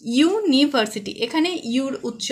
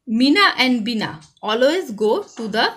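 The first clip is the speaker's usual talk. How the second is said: slow dictation; much slower than usual. Shown as a demonstration. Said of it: "reading exercise to pupils".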